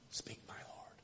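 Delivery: a hushed, breathy whisper of a prayer. Real, not acted. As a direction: barely breathe it to God. Pastor prays for God to speak.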